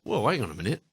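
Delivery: ordinary talk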